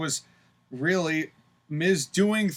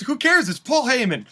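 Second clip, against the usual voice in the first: much higher and louder, laughing it off; excited; 8 semitones higher, 7 dB louder than usual. Real, not acted. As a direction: toss it off with a big laugh.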